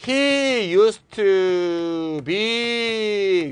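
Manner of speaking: very slow, stretched-out vowels; elongated, chant-like reading; drawn-out example. Shown as a demonstration. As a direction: stretch each word out long. Draws out the example sentence.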